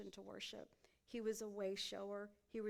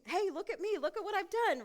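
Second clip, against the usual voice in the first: higher, louder, laughing show-off brag; mock boastful; 10 semitones higher, 8 dB louder than usual. Preacher mocking a show-off Jesus she says he isn't, laughing brag. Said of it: "just saying".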